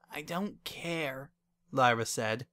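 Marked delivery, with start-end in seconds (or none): 0.1-1.3 soft, slow, listless refusal; dejected. A narrator's reading. Lyra in bed brushing off Wendy, dejected. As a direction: sulk it quietly, giving up.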